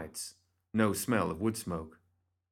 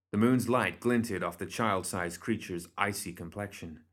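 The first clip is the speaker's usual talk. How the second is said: usual speaking voice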